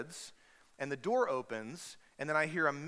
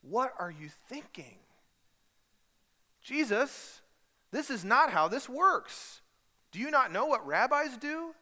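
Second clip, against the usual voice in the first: exasperated; 8 semitones higher, 4 dB louder, slower than usual. Retold or imitated. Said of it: "implies the tone that he's saying to Jesus".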